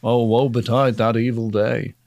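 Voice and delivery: mock archaic lament. Archaic dramatic recitation.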